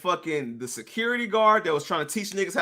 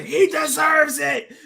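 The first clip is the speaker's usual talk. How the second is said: mock wrestler shout; 9 semitones higher, slower than usual. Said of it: "and say".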